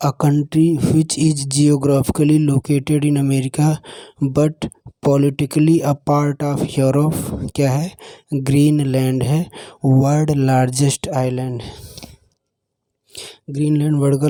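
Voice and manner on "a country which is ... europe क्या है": slow dictation. Shown as a demonstration. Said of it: "teacher repeats the question".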